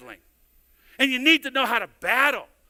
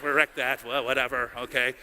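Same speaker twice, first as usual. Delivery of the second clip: grumbled aside; 8 semitones lower than usual. Retold or imitated. Retelling ruined family night.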